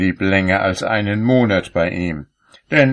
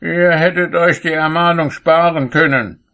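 raised-pitch, self-assured rebuff; huffy retort; 10 semitones higher, 4 dB louder than usual. A narrator's reading. Cobbler answers Saint Peter's warning, high and huffy.

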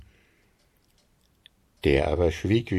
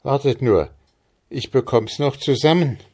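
put-on higher, eager young man; eager recalling; 6 semitones higher, 3 dB louder than usual. A narrator's reading. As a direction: hold them off while you remember. Andres straining to recall the rhyme.

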